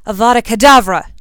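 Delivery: loud, high killing-curse shout. Acted. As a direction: blast the spell across the room. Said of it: "shouted Draco".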